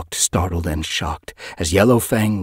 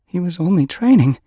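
high, upset, shaking plea; 13 semitones higher, 6 dB louder than usual. A narrator's reading. Grieving mother questioning her son's death, shaking voice. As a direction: let grief shake and lift the voice. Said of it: "her voice shaking".

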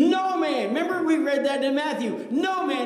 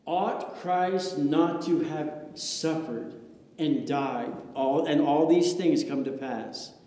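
Jesus speaking; 7 semitones lower, 3 dB quieter than usual. Acted. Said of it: "Jesus said this".

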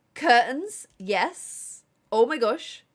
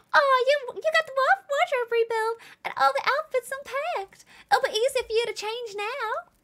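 very high, gasping character voice; high put-on delighted voice; excited game character; 11 semitones higher than usual. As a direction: gasp and gush it in character.

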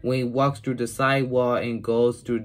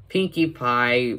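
usual speaking voice

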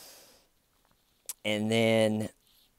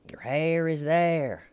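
high, slow, deliberate; insistent interrogator; 7 semitones higher, slower than usual. Retold or imitated. Red Shirt insisting the hair is there, slow and high. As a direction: spell out each word so he can't dodge it. Interrogator right in his face.

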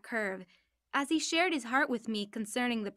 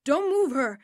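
a loud, higher warning shout; calling out; 4 semitones higher, 7 dB louder than usual. Retold or imitated. Truck driver shouting a warning to the rescuer, loud. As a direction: yell it to stop someone touching her. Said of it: "calling out".

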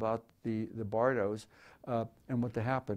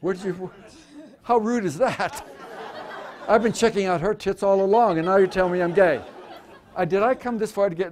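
loud, laughing mock indignation; loud, high, laughing fake offence; mock outrage; 6 semitones higher, 9 dB louder than usual. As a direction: play the insulted star, hammed up. Mock offence at audience question.

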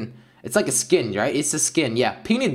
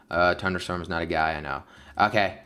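normal talking voice

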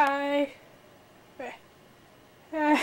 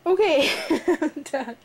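laughing; 4 semitones higher than usual. Real, not acted. Laughs while finishing.

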